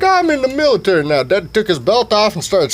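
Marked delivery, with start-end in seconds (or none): 0.0-1.2 mock general. General voiced high.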